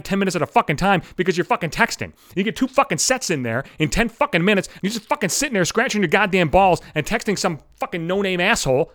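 ordinary talk

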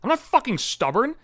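high, heated, exasperated protest; retold snapping. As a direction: re-enact losing patience, pitch shooting up. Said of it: "and I fucking snapped".

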